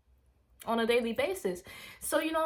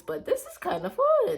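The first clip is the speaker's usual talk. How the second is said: high, louder burst of thrill; a retold giddy squeal; nervous excitement; 12 semitones higher, 5 dB louder than usual. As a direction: let the fear tip into fun. Nervous excitement riding horse.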